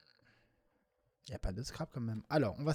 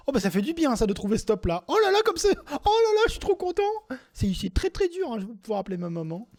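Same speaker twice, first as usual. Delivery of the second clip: hammed-up joy, much higher and louder; mock ecstatic; 12 semitones higher, 11 dB louder than usual. Scrabble player hamming up his joy at finding top. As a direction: squeal like a kid who just won.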